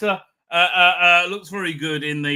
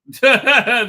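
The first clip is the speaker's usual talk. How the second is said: short, loud, high laugh; burst of laughter; 6 semitones higher, 9 dB louder than usual. Real, not acted. Host after mocking a bad movie, laughing. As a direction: laugh out at your own dig.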